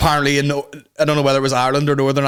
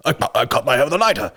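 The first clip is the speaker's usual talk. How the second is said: mock posh guy; 3 semitones higher, faster than usual. Mock reply of rich guy.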